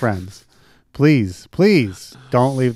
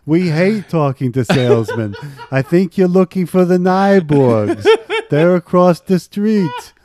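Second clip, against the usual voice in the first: put-on higher, louder, laughing Yiddish-accented grumble; 5 semitones higher, 4 dB louder than usual. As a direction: play a grumpy Yiddish neighbor. Mock old neighbor shooing salesmen.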